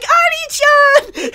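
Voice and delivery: put-on high, drawn-out "Ani-chan!" shrieked like a small girl; little girl's scream. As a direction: stretch "Ani-chan!" into a slow, piercing child's wail. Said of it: "the way she screamed".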